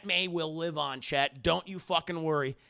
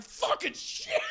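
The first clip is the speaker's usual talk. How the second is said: high panicked squeak; slower than usual. Retold or imitated. Venom freaks out.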